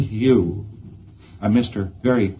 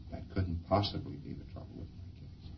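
voiced whisper-soft, unbothered preclear; calm denial; 7 semitones lower, 20 dB quieter than usual. Preclear calmly denying to his auditor, hushed and unbothered. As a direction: brush it off quietly, as if nothing is wrong. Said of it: "looks at you very calmly".

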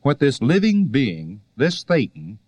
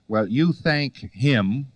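ordinary talk

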